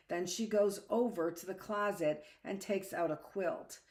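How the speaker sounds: usual speaking voice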